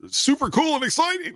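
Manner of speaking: high-pitched gushing hype; mock excitement. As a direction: squeal it like an over-keen fan. Mock hype about big ticket items.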